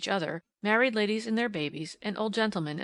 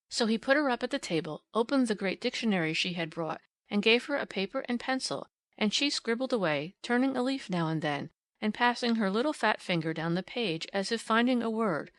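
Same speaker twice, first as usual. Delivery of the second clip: ordinary delivery, nothing marked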